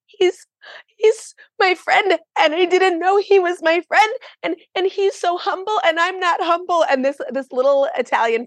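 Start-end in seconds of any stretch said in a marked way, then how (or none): 0.1-6.9 high, teary pleading through tears; retelling a breakdown to a friar, crying high; retold bawling. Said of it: "I just started to bawl".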